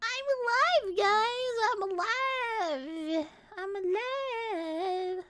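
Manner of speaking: high, drawn-out sing-song call; slow, high calling out; announcing I'm alive. Real, not acted. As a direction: stretch it out like a proud announcement. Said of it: "waiting for people to realize that I'm alive".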